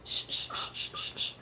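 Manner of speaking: soft whispered shushing. Real, not acted. Hushing crying baby.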